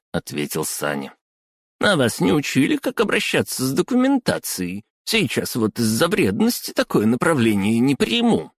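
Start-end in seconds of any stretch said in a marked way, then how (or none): 1.8-8.5 high squeaky lecturing woman. Acted. Clerk lectures him.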